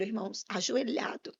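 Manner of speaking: normal talking voice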